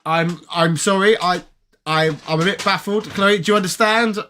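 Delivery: ordinary talk, nothing marked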